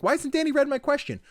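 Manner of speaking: high-pitched imitation of a listener; mock listener complaint. Listener waiting on a backlogged question, high mock complaint.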